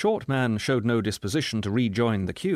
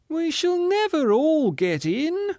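much higher, slow whining woman; a woman in a queue whining, much higher and slow; whine; 4 dB louder, slower than usual. Acted. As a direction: whine it out plaintively. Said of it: "a female voice with a whine in it".

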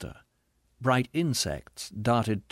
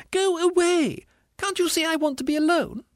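high, loud, frantic squeal; squealing ghost; 4 dB louder than usual. A narrator's reading. Ghost wanting to be alone, squealing. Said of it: "squealed the ghost".

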